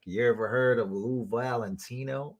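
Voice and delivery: lightly imitated Southern accent; mock Southern accent, understated. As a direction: try on a hint of a Southern accent. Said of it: "I love this accent".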